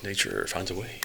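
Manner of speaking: soft, lightly done movie-actor impression; a hint of the actor's voice, kept quiet; Jeff Goldblum. Co-host quoting the film scientist, light soft impression. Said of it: "that great Jeff Goldblum person".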